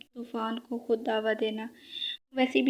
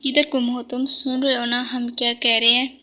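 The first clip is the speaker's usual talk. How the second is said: louder, stern scolding; angry father; 9 dB louder than usual. A narrator's reading. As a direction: snaps at his distracted son. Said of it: "غصے سے اس کو کہا".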